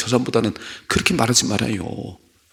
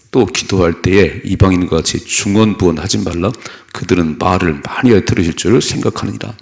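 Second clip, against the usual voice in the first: scripture reading; 3 semitones lower, 5 dB louder than usual. Acted. Preacher reading Matthew aloud, loud and measured. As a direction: read it out to the congregation.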